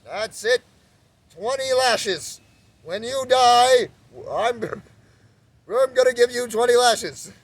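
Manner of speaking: high, loud, slow booming judge; mock stern God. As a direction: play a pompous punishing God. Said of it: "We think there's some God up there like".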